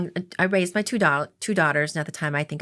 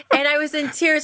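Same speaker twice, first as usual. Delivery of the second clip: high, louder laugh through the words; 12 semitones higher, 4 dB louder than usual. Real, not acted. Woman recalls crying on the phone to her sister, laughing. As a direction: laugh while recalling your own tears.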